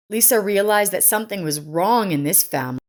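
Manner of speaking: usual speaking voice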